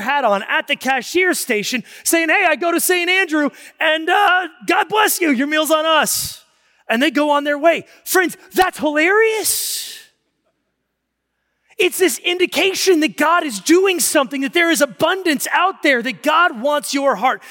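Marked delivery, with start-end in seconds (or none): 2.3-6.4 higher, louder cheerful call; cheery greeting. Retold or imitated. Joe greets customers at register. 8.0-17.4 impassioned preaching. Acted. Climactic preaching.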